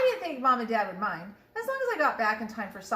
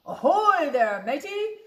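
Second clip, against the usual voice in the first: loud, higher pirate hail; pirate yell; 6 semitones higher, 6 dB louder, slower than usual. A narrator's reading. Head pirate calling to the boy on the beach, loud hail. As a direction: hail the boy like a swaggering captain. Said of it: "the head pirate climbed out of the boat and yelled".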